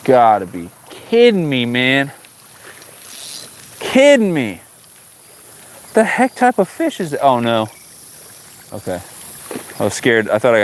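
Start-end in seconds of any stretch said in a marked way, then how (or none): 0.0-7.2 slow, drawn-out exasperation; can't believe the fish escaped; exasperated disbelief. Real, not acted. Lost fish frustration.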